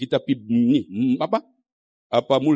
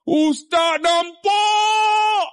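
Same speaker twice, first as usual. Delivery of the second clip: long, high, drawn-out wail; imitating an overcome, tearful man; tearful cry; 4 dB louder, much slower than usual. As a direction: call it out high and stretch the last word. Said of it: "Bila nampak air mata keluar".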